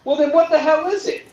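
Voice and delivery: high-pitched retold exasperation; worked-up mock indignation; exasperated. Retelling his baffled argument with seller.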